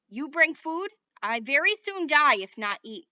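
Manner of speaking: a small warrior demanding food; Little Bear. A narrator's reading.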